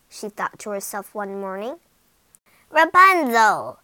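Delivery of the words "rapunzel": loud, slow call up the tower; witch calling out. Acted. Witch calling up to Rapunzel from outside, loud. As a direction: call her name from far below. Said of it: "the witch called from outside".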